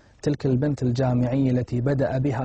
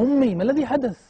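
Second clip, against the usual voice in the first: high, louder urgent cry to mother; alarmed question; 11 semitones higher, 5 dB louder, faster than usual. Acted. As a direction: ask in rising panic what has happened. الابن يسأل أمه بعد صراخها وإغمائها. (سؤال ملهوف مفزوع)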